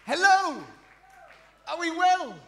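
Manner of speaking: high, loud call out to the audience; rally the room with a big hello; loud crowd greeting. Real, not acted. Comic shouting hello to the whole crowd, loud and high.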